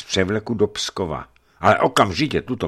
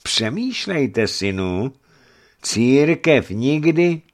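slow, higher coaxing; insinuating persuasion; sly, unctuous; 8 semitones higher, 5 dB louder, slower than usual. A narrator's reading. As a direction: draw it out like an oily cleric. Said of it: "podotkl Schönwald úlisně".

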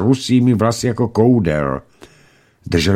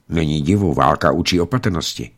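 ordinary delivery, nothing marked

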